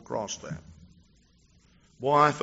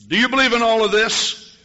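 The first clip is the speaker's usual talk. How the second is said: challenging call; 11 semitones higher, 10 dB louder than usual. Retold or imitated. Pastor challenges girl at pyramid.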